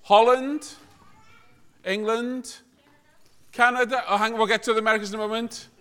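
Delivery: ordinary talk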